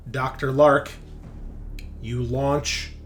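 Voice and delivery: ordinary delivery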